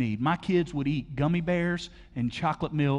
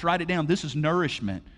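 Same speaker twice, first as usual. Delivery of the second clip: ordinary talk, nothing marked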